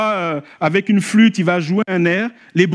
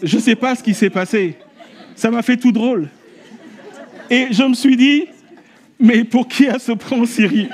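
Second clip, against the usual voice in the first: laughing; 7 semitones higher, 3 dB louder than usual. Real, not acted. Laughing while retelling.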